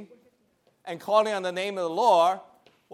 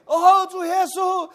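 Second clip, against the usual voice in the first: high shouted call; fervent high shout; 10 semitones higher, 6 dB louder, slower than usual. Retold or imitated. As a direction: cry out like the whole meeting. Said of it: "They were all over the place".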